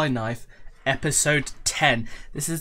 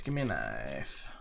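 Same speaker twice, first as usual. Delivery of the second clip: much lower and softer, almost giving up; fading plea; 11 semitones lower, 7 dB quieter than usual. Real, not acted. Opening cases, begging again, fading plea. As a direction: let the plea sink away.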